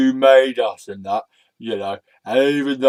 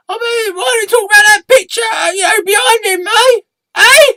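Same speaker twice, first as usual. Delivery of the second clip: squeaky, high puppet voice shouted; a shrill little puppet yelling to get his turn; high cartoon puppet shout; red sock puppet; 10 dB louder than usual.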